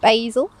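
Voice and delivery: "Basil" said BAY-zul with long a; American "Basil", first syllable like bay; American pronunciation. Shown as a demonstration. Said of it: "as the Americans say".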